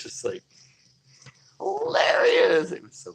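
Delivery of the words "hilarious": high, slow, loud laugh-cry; laughing shout. Real, not acted. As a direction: squeal the word through real laughter. Laughing at the memory.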